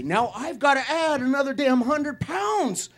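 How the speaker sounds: indignant rant. Real, not acted. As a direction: rant about it, getting riled. Indignant rant about extra weight.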